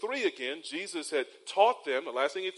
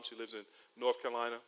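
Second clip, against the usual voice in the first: usual speaking voice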